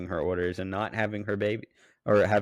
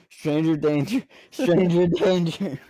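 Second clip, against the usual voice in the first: high singsong; 9 semitones higher, 5 dB louder than usual. Retold or imitated. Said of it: "I'm texting Devin".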